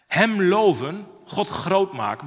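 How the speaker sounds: slow, weighty sermon refrain; slow solemn refrain; slow preaching. Acted. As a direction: lean on each word.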